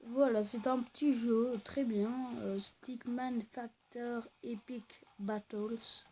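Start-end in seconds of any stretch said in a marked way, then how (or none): 2.6-6.0 game title read slowly in a French-accented attempt at English. Real, not acted. Gamer reading the English game title to viewers, in a slow French-accented English. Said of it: "mon accent anglais".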